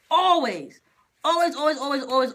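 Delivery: "Always" hit high and loud, then repeated; emphatic repetition. Real, not acted. Venting about family's assumption, emphatic "always". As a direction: punch the first "Always", then ease down through the repeats.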